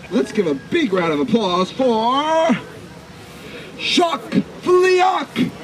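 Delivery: drawn-out high shouted introduction; grandiose flea circus showman. Acted. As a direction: call the name out to the back row. Big introduction to applause.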